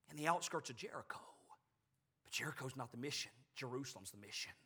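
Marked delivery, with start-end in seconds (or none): none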